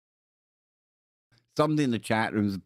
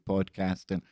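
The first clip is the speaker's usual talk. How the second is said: normal talking voice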